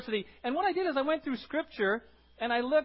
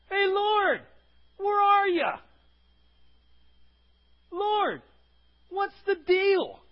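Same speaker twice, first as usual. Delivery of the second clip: much higher, louder mock plea to God; imitating accusing God; 11 semitones higher, 8 dB louder, much slower than usual. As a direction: call up to the Lord like a frustrated believer. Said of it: "You ever been there in the midst of adversity?".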